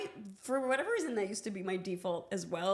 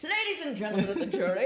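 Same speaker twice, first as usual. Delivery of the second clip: lightly voiced Southern lawyer, louder, faster; 3 semitones higher, 6 dB louder, faster than usual. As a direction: make the closing argument with Southern flair. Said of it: "That is my favorite bit".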